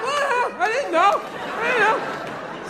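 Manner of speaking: high-pitched, drawn-out cluelessness; playing dumb. Shoplifter caught by security, playing dumb. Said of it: "you just play dumb".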